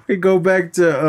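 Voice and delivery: normal talking voice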